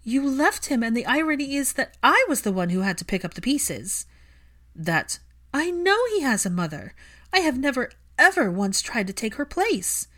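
loud, high, furious accusations; a woman accusing her partner, loud and high. Acted. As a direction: lash out at him. Furious quarrel with Draco.

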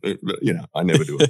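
ordinary delivery

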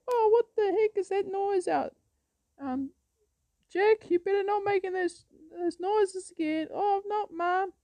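high falsetto scolding mother; shrill mom telling off her son. Retold or imitated. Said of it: "And the lady came out".

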